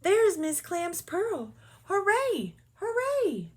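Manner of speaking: high joyful cheers; squealing happy cheer; excited shouting. Acted. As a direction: shout with glee. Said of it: "Miss Shimmer shouted".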